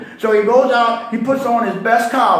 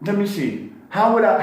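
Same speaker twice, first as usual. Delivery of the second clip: ordinary talk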